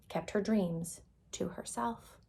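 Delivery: whispered sadly; hushed narrator whisper. A narrator's reading. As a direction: let it fade into a whisper. Hiding her dreams away.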